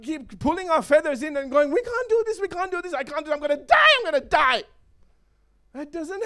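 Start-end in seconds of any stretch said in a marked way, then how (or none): 1.7-4.6 high, frantic mock panic; panicking chicken. Preacher mimicking chickens afraid to fly, panicked. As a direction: wail in exaggerated terror, climaxing loud.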